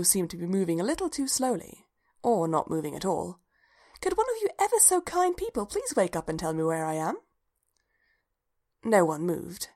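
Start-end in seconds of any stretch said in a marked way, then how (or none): 4.0-7.2 high-pitched, over-polite plea to strangers; polite appeal. A narrator's reading. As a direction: plead politely, pitched well up. Lost dreamer appeals to frozen strangers.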